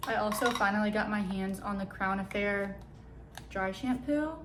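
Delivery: ordinary delivery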